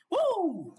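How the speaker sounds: a sudden high, loud woo; excited whoop. Real, not acted. Preacher whooping to fire up listeners, excited. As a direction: let a whoop fly to lift the room. Hyping up the crowd.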